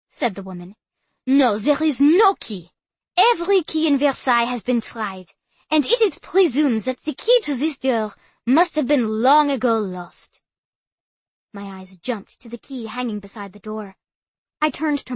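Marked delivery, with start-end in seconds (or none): 1.3-10.4 put-on loud tour guide, French accent; French guide addressing tourists. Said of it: "heavy French accent".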